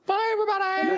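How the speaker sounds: very high squeaky sign-off voice; squeaky goodbye. Pretend.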